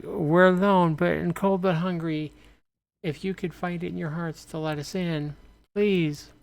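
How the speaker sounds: pleading stranger. Acted. Stranger begging through door.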